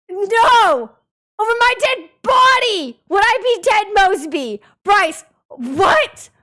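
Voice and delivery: loud, high, hammed-up protest; mock outraged denial. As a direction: ham up the horror of the comparison. Denying being Ted Mosby.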